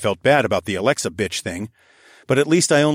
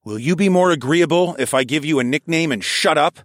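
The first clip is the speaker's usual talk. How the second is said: raised, exasperated comeback; irritated retort; 6 semitones higher, 4 dB louder than usual. Acted. Driver shoots back at the AI, irritated. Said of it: "I shot back".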